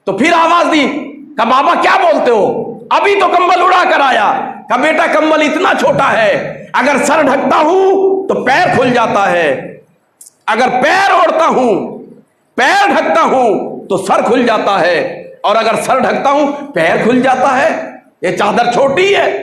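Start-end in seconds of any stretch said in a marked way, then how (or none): 1.4-4.3 grandson answering. Retold or imitated. 4.8-19.4 put-on old man complaining. Old man complaining of small blanket.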